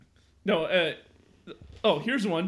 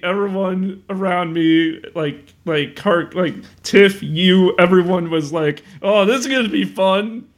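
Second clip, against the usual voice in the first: loud excited; 2 semitones higher, 11 dB louder, slower than usual. Real, not acted. Excited retelling.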